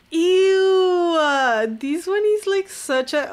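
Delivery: loud, high disgusted ew. Real, not acted. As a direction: recoil at it loudly. Disgust at old tweet.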